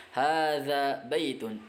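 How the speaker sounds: Hadza baitun spelled out slowly, each syllable clear; teacher modelling Arabic words slowly; slow model reading. Shown as a demonstration. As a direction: read Hadza baitun slowly for learners to repeat. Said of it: "Di sini hadza baitun".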